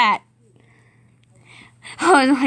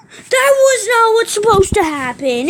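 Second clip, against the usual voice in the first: dismayed outcry; 7 semitones higher than usual. Real, not acted. Move in game goes wrong.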